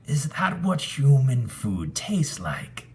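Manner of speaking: high, wondering ghost; a spirit puzzled by human food; curious ghost voice. A narrator's reading. Ghost asks Desmond about food, high and curious. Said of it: "the ghost asked".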